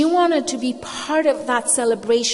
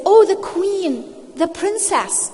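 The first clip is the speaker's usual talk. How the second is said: put-on high, sugary cooing over a baby; imitating doting adults, pitched way up; mock gushing; 9 semitones higher, slower than usual. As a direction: coo it like an admiring onlooker.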